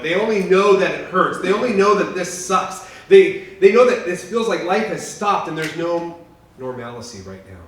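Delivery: loud, rising preacher's cry; loud impassioned sermon peak; preaching at full force. Real, not acted. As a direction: pound it home to the congregation. Preacher building to a climax.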